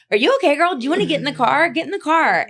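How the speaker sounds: high, loud, insistent offer; imitated urgent calling. Voicing the girls rescuing her from the man, imitated urgent calling. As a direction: urge her in, pitched well up.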